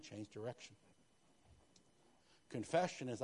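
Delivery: usual speaking voice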